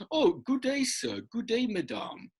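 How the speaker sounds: a lightly posh, high olde-worlde greeting; teacher hamming up old English courtesy; mock old English formality. Teacher imitates old English speakers, mock formal greeting. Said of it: "in old English, they would say".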